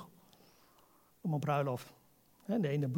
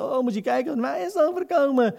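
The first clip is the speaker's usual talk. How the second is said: higher, whiny mock self-pity; imitating sister; 6 semitones higher, 5 dB louder than usual. Preacher mimicking his self-pitying sister, higher whiny mock voice. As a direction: mimic a sister feeling sorry for herself. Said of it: "Ik zei".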